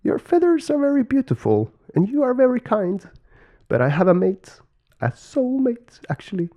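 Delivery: very high, sweet character voice; smiling ladybird. A narrator's reading. Ladybird turning down two suitors, high smiling voice. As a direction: let them down gently in a high voice. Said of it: "she replied with a smile".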